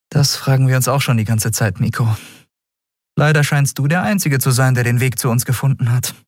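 mildly practical captain; easygoing captain. A narrator's reading. Captain answers the new boy.

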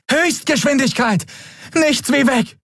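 high urgent female voice; urgent order. A narrator's reading. Endriel urging the crew to flee at top speed.

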